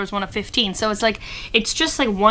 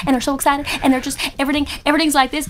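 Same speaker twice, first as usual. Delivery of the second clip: excited version; 5 semitones higher, much faster than usual. Shown as a demonstration. Singer shows the excited Nashville way of talking, bouncy. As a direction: say it all in a rush of excitement. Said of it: "we have the excited version".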